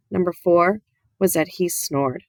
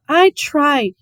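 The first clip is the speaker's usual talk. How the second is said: high, louder, anguished; anguished plea; 8 semitones higher, 6 dB louder than usual. Acted. The preacher to Opal about her mama, anguished and louder. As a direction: push it out in pain. Crying father repeats himself.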